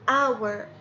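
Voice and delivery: "اور" with no h sound, starting on the vowel; silent h. Shown as a demonstration. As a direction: drop the h: "اور" opens straight on the vowel. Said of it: "اسمعوا مرة ثانية".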